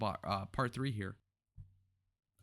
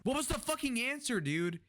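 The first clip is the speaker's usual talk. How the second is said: exasperated; 13 semitones higher than usual. Real, not acted. Yelling at evasive answer.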